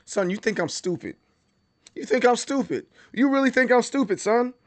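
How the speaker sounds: put-on disbelieving parent challenging a kid; mock scolding parent. Parent acting out scolding their kid.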